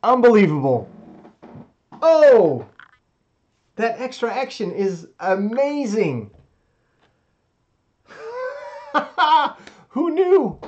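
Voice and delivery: loud high squealing laughter; overjoyed, half laughing, half crying; shrieking jackpot winner. Real, not acted. Celebrating the win.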